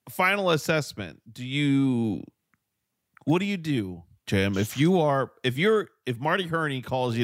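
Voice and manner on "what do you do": normal talking voice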